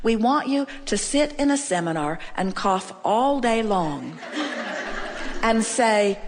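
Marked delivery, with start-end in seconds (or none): 4.2-5.4 quiet breathy chuckle; a little airy laugh at the joke; soft laugh. Real, not acted. Joking about coughing in a seminar, soft breathy chuckle.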